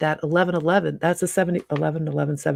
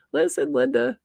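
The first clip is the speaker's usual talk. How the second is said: quoting the video; 7 semitones higher than usual. Retold or imitated. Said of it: "I'm like".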